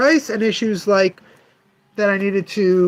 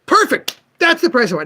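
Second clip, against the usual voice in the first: higher, eager mock customer; imitating the pleased customer; 6 semitones higher than usual. Shop owner mimics a collector happily accepting the price, higher. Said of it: "He goes".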